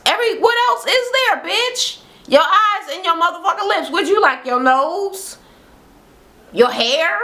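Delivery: high-pitched mocking disbelief; incredulous. Real, not acted. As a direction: pitch way up, scoffing at the complaint. Mocks friend's complaint with incredulity.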